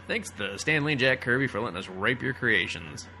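usual speaking voice

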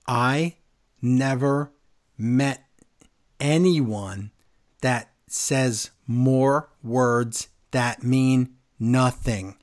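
each word separated, very slow; robot-slow speech. Retold or imitated. Friend mocking Liv Morgan, slow robotic monotone. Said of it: "she talks like a robot".

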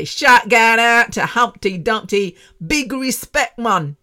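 loud, high, drawn-out street radio shout-out; big it up in exaggerated street lingo; street slang. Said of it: "if I was talking slang".

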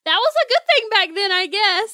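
high, loud mock character voice; silly put-on voice. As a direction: pipe up loudly in a daft high voice. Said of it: "still doing the voice".

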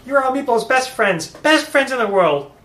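high, loud, gleeful little kobold; ecstatic kobold Meepo. Acted. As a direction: squeal with joy like Meepo.

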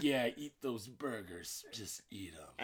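soft, slow coaxing mutter; quiet coaxing. Retold or imitated. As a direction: egg him on softly. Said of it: "he's just like".

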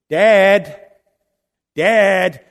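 calling Dad loud from the next room; a scared boy calling his father; child calling out. Retold or imitated. Son calling from bed at night.

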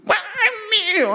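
put-on high-pitched, drawn-out toddler wail; mock a little kid claiming a toy, stretched out high; small child. Preacher imitating a small child over a toy, high possessive wail. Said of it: "Sin da piccolini".

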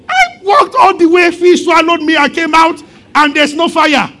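angry shout. Acted. Jonah shouting back at God in anger. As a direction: yell it like a prophet cheated of his fire. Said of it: "Jonah, are you angry?".

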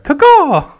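high, loud mock parrot caw; shrill imitation bird call; parrot squawk. Mimics the station's parrot.

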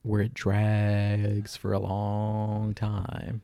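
dragged way out, each word stretched; slow monotone stretch; drawn out. Shown as a demonstration. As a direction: say it like it drags on forever. Mimicking a dragging show.

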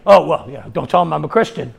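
low, hushed, evasive admission; ashamed of faith. Acted. Shy believer asked what they are, sheepishly hushed. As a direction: mumble it as a mock secret. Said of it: "why you have such a shame".

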